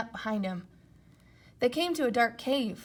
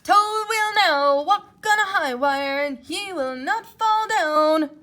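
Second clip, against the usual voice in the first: announcer voice; 8 semitones higher, 12 dB louder, slower than usual. Acted. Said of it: "said the voice".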